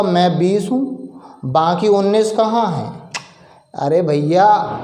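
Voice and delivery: usual speaking voice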